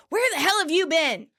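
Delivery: high, indignant demand; Monica scolding. Retold or imitated. Monica hitting her brother on return, indignant.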